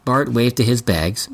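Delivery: ordinary delivery, nothing marked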